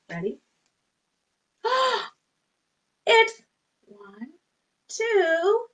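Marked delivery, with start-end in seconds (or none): 1.6-2.0 high sharp gasp; surprised gasp. Pretend. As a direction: gasp at the reveal. Surprise at new animal.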